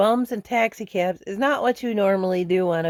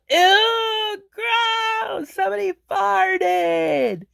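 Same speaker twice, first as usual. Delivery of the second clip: mock yell; 12 semitones higher, much slower than usual. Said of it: "somebody yelled".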